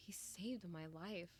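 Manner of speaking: ordinary talk, nothing marked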